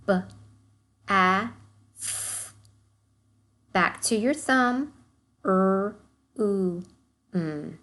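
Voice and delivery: each sound of bathroom said apart with a pause; segmenting sounds. Shown as a demonstration. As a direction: tap out B, A, F one sound at a time. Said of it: "say each sound that we hear".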